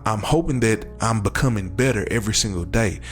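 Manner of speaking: ordinary talk, nothing marked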